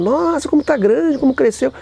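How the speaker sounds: mock-sweet high exclamation; high, gushing old lady. A woman at the market gushing over a grown child, high and sweet. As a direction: coo it like a surprised old lady.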